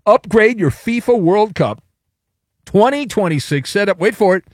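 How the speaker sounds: big ad tagline; hyped ad read. Acted. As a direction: punch the slogan.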